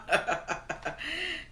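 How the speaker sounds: short breathy chuckle at the chat; light real laugh. Real, not acted. Reading chat's laugh out loud.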